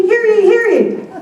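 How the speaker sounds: loud town crier call; shouted proclamation. Shown as a demonstration. Host demonstrating the captain's call, loud herald cry. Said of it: "and screaming".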